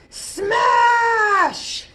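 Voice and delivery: high, loud shouted smash. Acted. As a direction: crash it out like cymbals. Cymbals crash in story.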